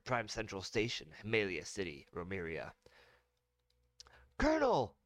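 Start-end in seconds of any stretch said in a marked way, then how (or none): 4.4-5.0 startled, high, loud call; surprised call. Acted. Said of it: "said in surprise".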